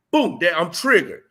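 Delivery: ordinary talk, nothing marked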